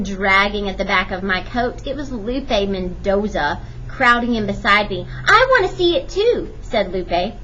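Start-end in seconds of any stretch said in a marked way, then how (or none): 5.3-6.5 put-on high eager girl voice; high and eager like a small girl; little girl voice. A narrator's reading. Girl voiced in read story.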